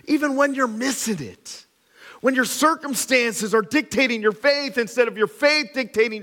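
loud, high-pitched rising preaching; full-force preaching. Real, not acted. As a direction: build the appeal with fire.

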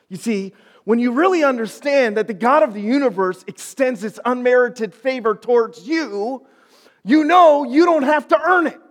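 high, loud sermon voice rising to a climax; forceful preaching, building. Real, not acted. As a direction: preach it with mounting conviction.

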